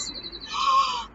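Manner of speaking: high, excited gasp; big delighted breath in. Real, not acted. Teacher thrilled by a timely train, gasping.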